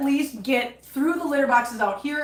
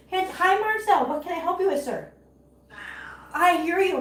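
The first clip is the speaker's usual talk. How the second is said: high, sing-song hello to the cat; high pet greeting; 6 semitones higher than usual. Real, not acted. Owner greeting her cat Marcel in a high sing-song.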